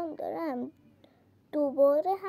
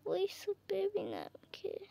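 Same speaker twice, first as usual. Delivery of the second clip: soft unvoiced whisper; 4 semitones higher, 8 dB quieter than usual. Real, not acted. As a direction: whisper it to yourself. Whispered aside while playing.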